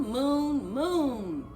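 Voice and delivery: plain reading voice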